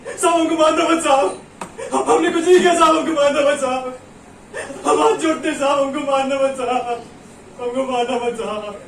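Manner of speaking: loud, desperate begging, sinking to a wail; terrified pleading. Acted. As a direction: beg not to be beaten. Labourer begs not to be beaten.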